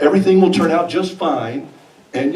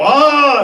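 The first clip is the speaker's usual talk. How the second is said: high cry of pain; 8 semitones higher, 4 dB louder than usual. Retold or imitated. Does the same thing again.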